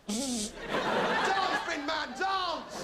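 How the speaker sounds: very slow, high, loud order; commanding shout. Pretend. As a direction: bellow the command like a ringmaster. Ordering bin men to dance.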